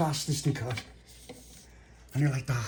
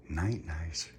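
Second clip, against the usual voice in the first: whispered goodnight; saying goodnight to everyone, whispered; 6 semitones lower, 11 dB quieter, slower than usual. Real, not acted. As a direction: breathe it out softly. Bedtime goodnight.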